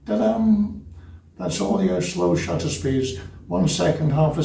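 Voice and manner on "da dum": high-pitched da-dum of a heart; imitated heartbeat. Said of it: "and his heart goes".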